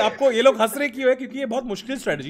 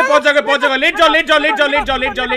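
loud, high, repeated commands; frantic shouting; 6 semitones higher, 8 dB louder than usual. Real, not acted. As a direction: shout urgent orders over and over. Urging teammate to drop down. (ज़ोर-ज़ोर से हड़बड़ी में हुक्म देते हुए)

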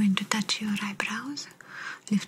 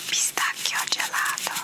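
right in your ear. Acted. Hearing test whisper at ear.

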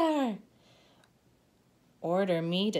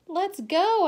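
cheerful urging; 11 semitones higher, 10 dB louder than usual. Acted. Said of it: "I say".

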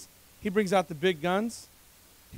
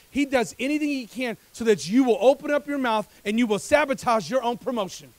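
full-force preaching; 8 semitones higher, 14 dB louder than usual. Real, not acted. Preacher warning the congregation about the enemy's distractions, full-force preaching. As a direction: build from raised voice to high, shouted preaching.

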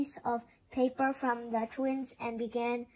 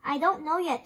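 usual speaking voice

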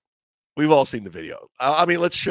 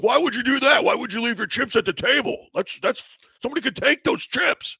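high-pitched scolding friend; exasperated scolding; 10 semitones higher than usual. Retold or imitated. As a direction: scold him like he did something absurd. John scolding him about chips.

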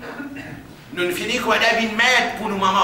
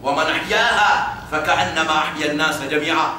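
Quran recitation; 4 semitones lower, much faster than usual. Acted.

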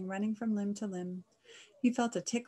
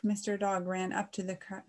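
usual speaking voice

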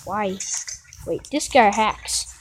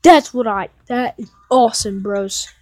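loud, higher, drawn-out trophy announcement; excited boast; 3 semitones higher, 10 dB louder, slower than usual. Real, not acted. Gamer celebrating a trophy count to viewers, loud excitement. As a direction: proclaim it slowly and loudly, thrilled.

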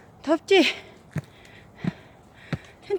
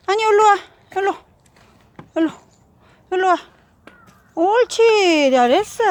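ordinary talk, nothing marked

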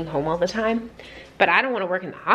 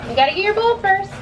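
sing-song urging to go get the ball; urging the dog in a high voice; high coaxing voice; 11 semitones higher than usual. Real, not acted.

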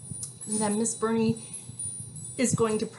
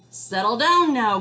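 grumpy porcupine; 10 dB louder than usual. Acted. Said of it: "grumped the prickly porcupine".

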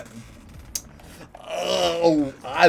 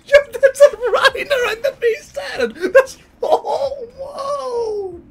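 laughing excitedly; 3 dB louder, slower than usual. Real, not acted. Laughing at titans appearing.

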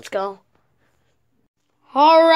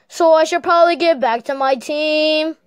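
ordinary delivery

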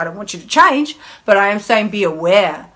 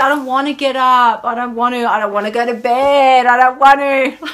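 put-on high, whining, childish complaints; whiny voice; 4 semitones higher, 4 dB louder than usual. Her inner child refusing food choices, whiny and high. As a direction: whine like a sulky kid. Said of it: "hear my whiny voice".

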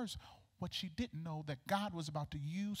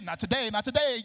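jubilant ad-lib; 7 semitones higher, 6 dB louder than usual. Acted. Ad-lib between sung praise lines.

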